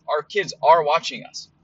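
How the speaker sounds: ordinary talk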